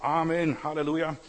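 loud, high amen and hallelujah; called-out amen. Retold or imitated. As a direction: shout it from the pews. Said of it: "bei uns war ganz üblich".

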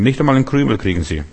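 normal talking voice

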